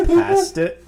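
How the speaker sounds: laughing high-pitched victory call; laughing triumph. Retold or imitated. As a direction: squeal it high and loud through laughter. Triumphant quip while laughing.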